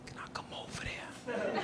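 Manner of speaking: barely audible, very soft whisper; retold whisper. New inmate asking to approach Cece, tiny whisper. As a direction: mouth it across the yard with almost no sound. Timidly asking Cece's permission.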